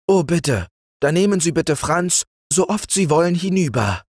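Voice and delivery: high, eager pleading. A narrator's reading. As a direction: beg him sweetly to take the boy. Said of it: "bat Frau Martha".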